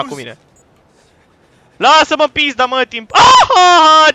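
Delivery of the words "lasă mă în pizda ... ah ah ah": loud, high outburst breaking into a long scream; startled yelling. Real, not acted. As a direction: yell in fright, easing off at the end. Startled by creature in game.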